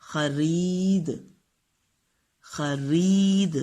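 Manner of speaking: خرید stretched very slowly; خرید drawn out for the class; drawn-out model word. Shown as a demonstration. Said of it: "اس میں بھی چھوٹی اے کی آواز".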